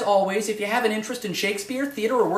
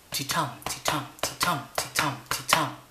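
soft, lower ti-tum with "tum" hit each time; heartbeat rhythm; 5 semitones lower, 7 dB quieter than usual. Shown as a demonstration. Teacher showing the iamb as a heartbeat, soft rhythmic chant. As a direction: tap out "ti-tum" like a heartbeat, quietly. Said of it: "imitates the sound ... of a human heart".

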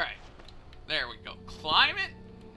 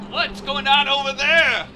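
loud, high-pitched mock alarm; game enemy; 7 semitones higher, 8 dB louder than usual. Startled enemy calls out to what's over there, mock alarm. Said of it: "It's like".

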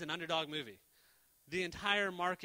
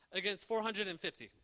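usual speaking voice

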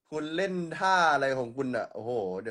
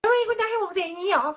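much higher, thin cartoon squeak; squeaky high voice; 5 dB louder than usual. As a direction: pitch right up into a squeak.

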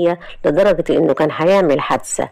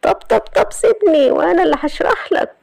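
high, strained pleading voice of a sly man; Ayman being choked; 12 semitones higher than usual. A narrator's reading. Ayman begs Ahmed to let go of his collar, high and strained. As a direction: squeak it out as he's being choked.

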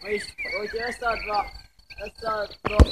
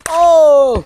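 loud exclamation; 5 semitones higher, 18 dB louder than usual. Real, not acted. Loud outro reaction.

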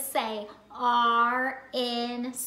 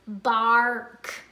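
ordinary delivery

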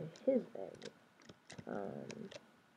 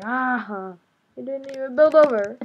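mock taunting; 5 semitones higher, 10 dB louder, slower than usual. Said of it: "It's like".

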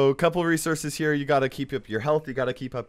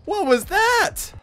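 high, slow, drawn-out exclamation; disgusted shock; 7 semitones higher, 6 dB louder, slower than usual. Real, not acted. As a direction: cry out in revulsion, stretching the words. Disgusted by a game monster.

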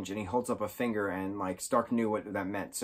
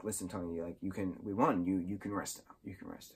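hushed and much softer, fading to barely a breath; tender farewell; 2 semitones lower, 6 dB quieter than usual. Retold or imitated. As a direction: ease him gently to rest, voice dropping away. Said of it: "Pepper's like".

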